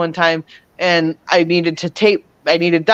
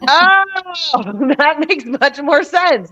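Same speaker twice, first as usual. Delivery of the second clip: high, slow, laughing "oh" of realization; 9 semitones higher, slower than usual. Retold or imitated. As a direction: let it dawn on her with a laugh.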